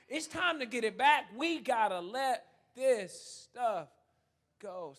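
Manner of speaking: high, loud sermon exhortation; impassioned preaching. Real, not acted. Preacher urges people to let old offenses go, forceful preaching. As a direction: drive it home to the congregation.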